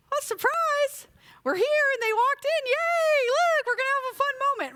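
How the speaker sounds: high, bright put-on cheer, quick at the end; fake surprise cheer. Parent to young kids, covering for absent friends, high fake excitement. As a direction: sell the surprise to the kids, all sparkle.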